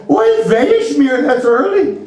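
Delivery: high, slow Irish exclamation; Irish accent. Acted. Irish caller balking at an early pickup, Irish accent. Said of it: "like, with that accent".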